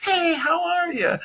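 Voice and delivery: sky-high squeaky put-on greeting; a cartoonishly high, insincere hello; fake enthusiasm. Shown as a demonstration. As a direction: overdo the cheer so it sounds fake. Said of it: "you don't wanna fake it".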